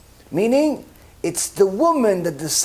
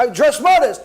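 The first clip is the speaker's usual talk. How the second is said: higher, louder indignant protest; insistent protest; 7 semitones higher, 4 dB louder than usual. Retold or imitated. Woman protesting to the heavenly court, indignant. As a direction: insist you did everything right.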